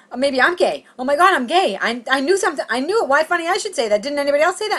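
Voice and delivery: high, loud panicked self-talk; unraveling. Retold or imitated. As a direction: spiral upward into mock panic. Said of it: "And I was, like, unraveling".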